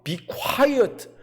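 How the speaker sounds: high-pitched mock scolding; imitated rebuke. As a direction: hush the noisy beggars, acting the crowd. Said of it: "막 꾸짖습니다". (꾸짖는 무리를 흉내 내듯 높게)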